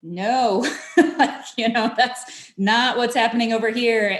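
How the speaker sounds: half-amused no, spoken through a laugh; mother laughing off the other moms' reading news; laughing denial. Retold or imitated. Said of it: "And I'm like".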